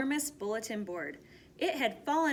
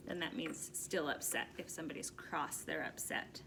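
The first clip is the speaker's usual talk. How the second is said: much softer aside explaining a word; quiet explanation; 5 semitones lower, 11 dB quieter than usual. Real, not acted. Reader explaining 'cross' to the children, soft aside. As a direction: lean in and explain gently. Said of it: "reader explains word to kids".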